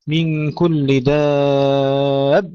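slow drawn recitation of من كل دابة; recited verse. Acted. Teacher chanting the verse to his students.

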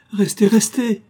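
high, loud, urgent plea; cry out. A narrator's reading. Tuttle cries out to stop Miss Lillerton leaving, loud and high. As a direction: call out to stop her leaving. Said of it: "cria monsieur Tuttle".

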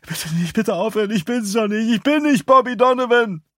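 very high, panicked begging; terrified high wail building in volume; desperate pleading. Acted. Hooded victim begs his tormentors.

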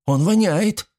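put-on high, squeamish young woman's cry; disgusted girl. Girl smelling the prisoner, high disgusted cry. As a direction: recoil from the stench, voice up high.

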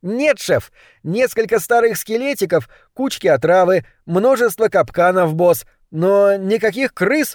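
high-pitched, eager rat reporting; rat henchman. A narrator's reading. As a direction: report like a little rat to the boss. (крысёнок рапортует высоким голосом)

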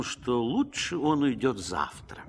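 usual speaking voice

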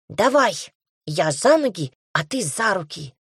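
plain reading voice

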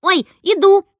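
put-on a kid jolted into hurrying; startled cry. A narrator's reading. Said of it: "испугался Гуся".

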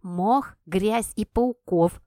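slow, distant voice from below; down a well. A narrator's reading. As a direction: call up from the bottom of a well. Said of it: "послышалось в ответ".

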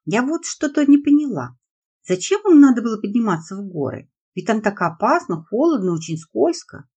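put-on high, loud anxious girl's voice; worried little girl. A narrator's reading. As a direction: fret like a worried daughter. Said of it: "наконец сказала Лиза".

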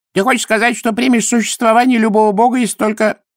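high-pitched, self-satisfied priest voice; challenging high priest. A narrator's reading. High priest smugly challenges Dorfl on belief, self-satisfied. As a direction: press the golem in a high voice.

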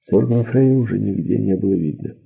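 plain reading voice

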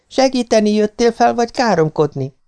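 very high, indignant wife; snapping at husband. A narrator's reading. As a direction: call down a high-pitched scolding. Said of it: "förmet rám hitvesem a magasból".

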